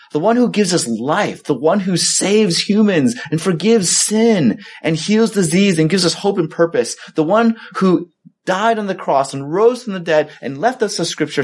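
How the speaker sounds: high-pitched, rolling litany; impassioned preaching. Real, not acted. Preaching crescendo on who Jesus is.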